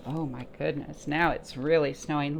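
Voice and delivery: plain reading voice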